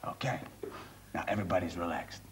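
very low, soft voice; forced calm. Acted. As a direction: hush down after an outburst. Conductor calms down after outburst.

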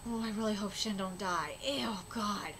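real whispered worry; worried hush. Real, not acted. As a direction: murmur anxiously to yourself.

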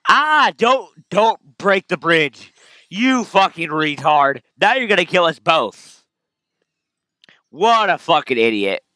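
loud furious outburst at the screen; angry yelling. Real, not acted. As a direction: yell at the idiot in the game, slow and hot. Raging at game character.

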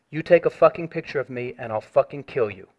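usual speaking voice

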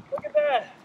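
high-pitched excited exclamation. Real, not acted. Admiring a point in the game, excited and high. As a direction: marvel at the shot, voice way up. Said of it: "I'm way too excited for that".

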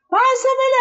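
put-on a kid pleading to be handed it; mock child voice. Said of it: "Y ustedes dicen".

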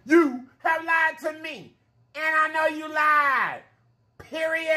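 high, slow playful scolding; drawn-out sassy "period"; sassy mock accusation. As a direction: tease them for not coming back.

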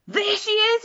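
excited. Acted. Baby bird spots the big thing.

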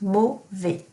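French teacher modelling mauvais; slow model word. Shown as a demonstration. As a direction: spell out mauvais sound by sound. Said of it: "It sounds like this".

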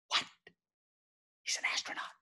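hushed, breath-only astonishment; stunned disbelief. Retold or imitated. Speaker learning the band dad is an astronaut, stunned. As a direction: whisper it as if floored.

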